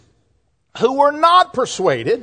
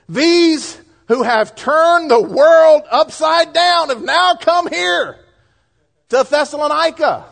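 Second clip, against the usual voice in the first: higher, slow accusing cry; accusing crowd; 6 semitones higher than usual. Retold or imitated. Pastor voicing the accusers against Paul, a slow raised cry. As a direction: proclaim it like an angry mob. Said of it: "here's how they're accusing them".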